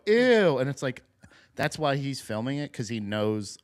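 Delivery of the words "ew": high, loud exaggerated revulsion; mock disgust. Viewers reacting to gross food video, loud mock disgust. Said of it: "for us to go".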